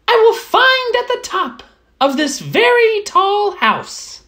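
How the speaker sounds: high, pleased little mouse voice; a small mouse admiring a cozy hut. Acted. Mouse admiring the straw hut, high and content.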